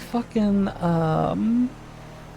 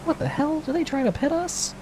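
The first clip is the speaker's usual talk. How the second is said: surprised exclaim; 11 semitones higher than usual. Real, not acted. Car tries to pit them.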